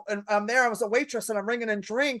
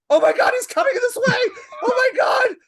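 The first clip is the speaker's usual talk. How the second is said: very high, loud panicked shriek; freaking out; 9 dB louder than usual. Retold or imitated. Waitress as her idol walks right up, freaking out. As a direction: starstruck scream at full pitch. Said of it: "I'm freaking out".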